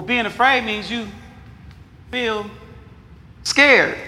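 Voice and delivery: ordinary delivery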